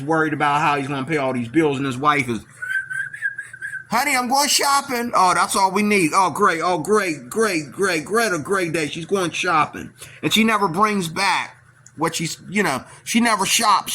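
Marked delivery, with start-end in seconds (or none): 3.9-5.1 high, breezy wife announcing a shopping trip; mock wife voice. Man imitating his wife announcing she is off shopping, in a high put-on voice. 5.1-9.9 sarcastic grumble. Retold or imitated. Husband's sarcastic reaction to wife.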